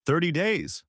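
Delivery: high, scornful bark of disbelief; barked echo. A narrator's reading. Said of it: "Zartok barked again".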